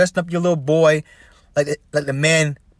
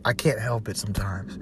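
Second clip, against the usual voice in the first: whisper; 4 semitones lower, 6 dB quieter than usual. Real, not acted. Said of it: "very passionate about this stuff".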